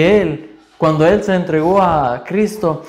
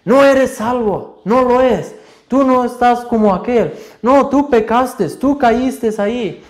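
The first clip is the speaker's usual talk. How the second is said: strong harsh voice; 9 semitones higher than usual. Retold or imitated. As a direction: shout the accusation down at them. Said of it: "va a estar una voz fuerte".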